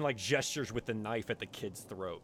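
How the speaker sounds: usual speaking voice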